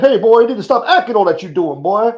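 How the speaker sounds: high, loud, scolding Reverend Al impression; outraged reverend scolding a joker; scolding preacher. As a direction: chew him out like an angry preacher. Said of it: "he probably, uh, be like".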